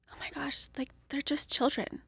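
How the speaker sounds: hushed realization, barely voiced; awed whisper. Mother whispers her sudden realization about her children. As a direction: breathe it out as if just seeing it.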